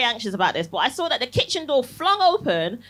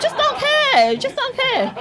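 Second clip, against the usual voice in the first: high, slow, laughing delight; gleeful; 13 semitones higher, 3 dB louder, slower than usual. Acted. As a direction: revel in the carefree feeling.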